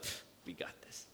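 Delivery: smug dismissive. Pretend. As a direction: brush it off like it's nothing. Mocking complacent church attitude.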